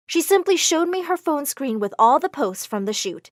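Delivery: plain reading voice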